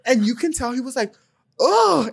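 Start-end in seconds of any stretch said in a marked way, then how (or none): 1.6-2.1 high mock groan of frustration; high-pitched huff, acting frustrated. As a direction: imitate an exasperated oh. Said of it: "like, so frustrated".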